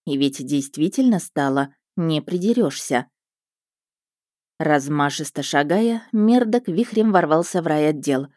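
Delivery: plain reading voice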